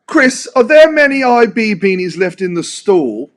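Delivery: mock British accent, drawn out. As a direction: play the British fan asking politely in a British accent. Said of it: "read it in his British accent".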